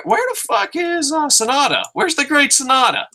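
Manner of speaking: very high, squealed demand; hammed-up high-pitched bewilderment; mock outraged cry. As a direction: wail it comically, way up in pitch. Mock outcry over missing wrestler.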